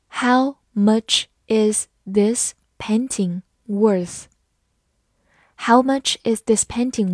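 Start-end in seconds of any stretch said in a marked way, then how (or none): 0.1-4.2 slow, word-by-word question; slow reading. Shown as a demonstration. Teacher modelling the painting price question for learners, slowly. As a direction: ask it slowly, a gap after each word. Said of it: "好，慢慢来读".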